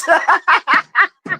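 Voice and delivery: short high giggle; bubbling high laugh; high giggling laugh. Real, not acted. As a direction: giggle at the clip. Laughing at video.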